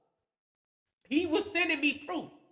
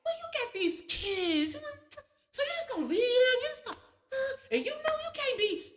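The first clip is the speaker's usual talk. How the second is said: high, soft, tearful plea; soft inner voice; 12 semitones higher than usual. Retold or imitated. Soft self reminding her of the kids, tearful and high. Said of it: "She be like".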